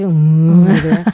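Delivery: loud, grudging hmm; insincere acceptance. Acted. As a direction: fake agreement in a heavy grunted hmm. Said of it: "intonasi yang juga tidak perlu meledak-ledak".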